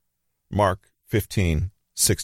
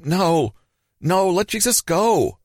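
very high, desperate plea; storyteller voicing distraught followers; crying out, shouting; 12 semitones higher, slower than usual. A narrator's reading. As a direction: plead in a high, drawn-out cry. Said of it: "crying and shouting to those next to them".

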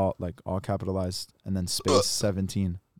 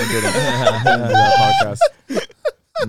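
high, slow laughing denial; 9 dB louder, much slower than usual. Real, not acted. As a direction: crack up while denying it.

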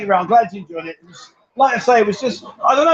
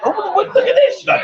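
high mock quote; 12 semitones higher, slower than usual. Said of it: "You said".

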